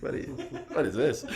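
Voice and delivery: mock incredulous, breaking into laughter; teasing disbelief through a giggle; laughing. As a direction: laugh at the question as you say it. Mocking her own email, laughing.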